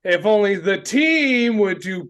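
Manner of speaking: mock passive-aggressive grumbling to the press. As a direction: stretch the key words in a pointed, sing-song complaint. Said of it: "the passive aggressive argument that they have with the media".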